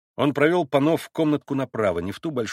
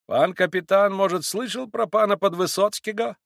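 lightly voiced Polish gentleman, higher pitched; Polish pan; 7 semitones higher than usual. A narrator's reading. Pan asking the captain about a legend, as a Polish gambler. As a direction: open a tale as a Polish pan.

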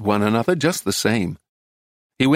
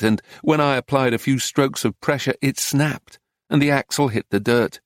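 plain reading voice

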